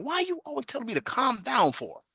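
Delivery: high, indignant whine at the manager; mock-indignant comeback to the boss; exasperated retort. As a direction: complain high and put-upon.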